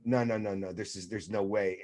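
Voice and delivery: soft, sinking no no no; watching disaster unfold, quietly dreading; hushed dread. Retold or imitated. Fan watching the catch, hushed dread. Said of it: "you're like going".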